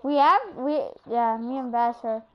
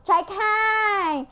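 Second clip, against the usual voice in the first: high, loud mock yell; imitating mad teammate; 7 semitones higher, 5 dB louder than usual. Player mimicking a teammate mad about the ball, mock angry yell. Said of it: "he gets all mad".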